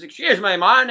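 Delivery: loud put-on American accent; brash tourist twang, bad American impression; a failed stab at an American accent. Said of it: "I won't even do the accent".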